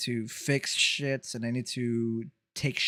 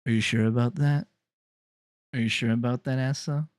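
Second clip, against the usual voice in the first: serious teasing voice; 3 semitones lower than usual. Pretend. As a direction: question it in a mock-serious tone.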